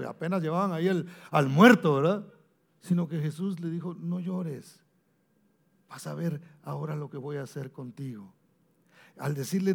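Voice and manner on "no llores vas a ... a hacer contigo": gentle reassurance. Retold or imitated. Jesus's gentle promise.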